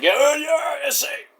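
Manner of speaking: high, drawn-out mock holler; mock wrestler taunt. Rocky Romero taunting in the corner, high mock holler. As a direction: stretch it high like a mocking wrestler.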